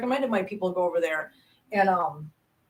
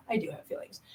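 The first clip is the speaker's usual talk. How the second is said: whispered admission; 12 dB quieter than usual. Real, not acted. Quiet confession of feelings.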